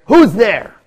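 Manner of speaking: loud, high shout through a door; irritated call. Acted. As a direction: call out sharply to unknown knockers. Innkeeper calls through the door.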